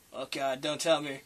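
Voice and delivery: usual speaking voice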